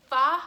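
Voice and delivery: "fa" with a loud clear F hiss; drilled syllable. Shown as a demonstration. Speech teacher drilling sounds, loud careful "fa". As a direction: punch out "fa", F lip on teeth. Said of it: "We're gonna try to say".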